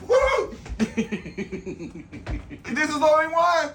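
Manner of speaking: yelling through the burn; high excited shout. Real, not acted. Burning from hot sauce.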